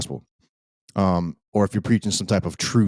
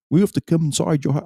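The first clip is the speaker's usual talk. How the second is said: high-pitched, hurried mock British policeman; badly faked British police accent; terrible British accent; 8 semitones higher, faster than usual. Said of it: "terrible accent".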